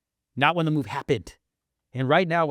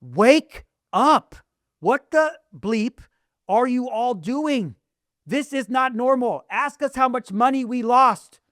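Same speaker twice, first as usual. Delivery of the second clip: higher, louder, slow ranting shout; yelling rant; 8 semitones higher, 4 dB louder, much slower than usual. Acted. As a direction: hammer each line at sleepers. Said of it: "I wanna yell".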